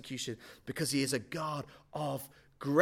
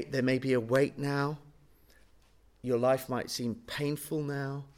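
ordinary talk, nothing marked

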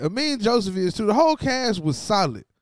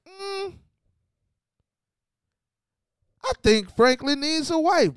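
mocking the writers; 7 semitones higher than usual. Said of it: "the writers just said".

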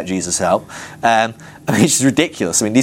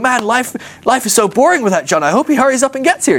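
mock longing; 11 semitones higher than usual. Imagined Jesus longing for John.

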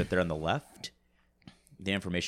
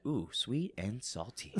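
Ryan Reynolds voice; 3 semitones higher, 7 dB quieter, slower than usual. Retold or imitated. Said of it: "Like Ryan Reynolds once said".

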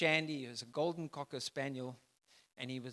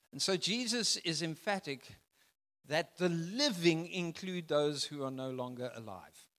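usual speaking voice

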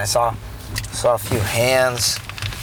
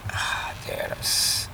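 real whispered sigh, very soft; breathy whisper; 9 dB quieter than usual. Real, not acted. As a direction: let the words out on a breath. Pauses mid-story, breathes out words.

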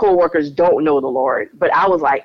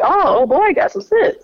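sinking realization; 11 semitones higher than usual. Retold or imitated. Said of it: "And I was like".

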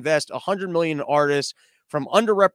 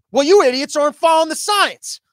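loud, higher, exasperated scolding; condescending scolding; 8 semitones higher, 7 dB louder than usual. Retold or imitated. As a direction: snap at them like a fed-up boss. Condescending boss scolding subordinates.

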